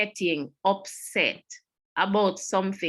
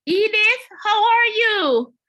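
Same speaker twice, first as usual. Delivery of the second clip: bright, much higher, slow greeting; high sing-song greeting; 9 dB louder than usual. Retold or imitated. Greeting Edith at church, high and drawn out. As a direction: sing out the hello, pitched way up.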